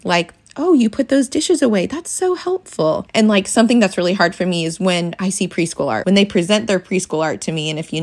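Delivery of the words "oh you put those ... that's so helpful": bright sing-song praise, pitched up; sweet high mom-to-kid praise. Shown as a demonstration. Praising child for dishes.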